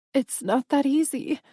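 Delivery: a tearful, desperate protest; tearful plea. Acted. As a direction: plead through tears. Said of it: "tears in my eyes".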